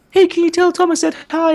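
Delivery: very high-pitched mock-fan voice; eager fan. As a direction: imitate a fan squeaking out a request. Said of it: "the fans would be like".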